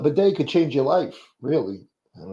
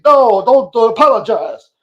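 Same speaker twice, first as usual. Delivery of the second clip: high, loud, scolding Reverend Al impression; outraged reverend scolding a joker; scolding preacher; 8 semitones higher, 8 dB louder than usual. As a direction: chew him out like an angry preacher. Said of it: "he probably, uh, be like".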